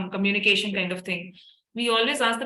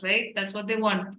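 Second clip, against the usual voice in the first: ordinary talk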